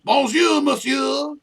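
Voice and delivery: high, loud hammed-up French bonjour; mock French greeting. Friend mocking a bad hello in a hammed-up French accent. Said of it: "He'll get all French on me".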